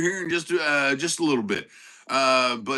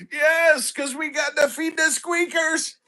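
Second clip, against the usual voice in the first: excited hype; 11 semitones higher, slower than usual. Real, not acted. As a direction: hype the dog up. Hyping up excited pet.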